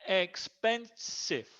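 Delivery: "Expensive" very slowly as ex - pen - sive; syllable by syllable. Shown as a demonstration. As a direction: break "Expensive" into three clear beats. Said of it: "three syllables".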